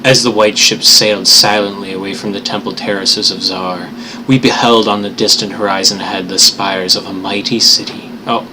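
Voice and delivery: put-on measured storybook reading of old prose; story narration. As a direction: read it like a tale by the fire. Resumes Lovecraft reading.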